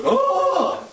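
high, laughing "Oh!" of shock; startled gasp. Acted. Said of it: "And he goes".

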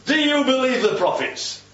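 high, ringing question; direct challenge. Acted. Prisoner eyeball to eyeball with the king, challenging him directly. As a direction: confront him head-on with the question. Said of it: "Then, eyeball to eyeball".

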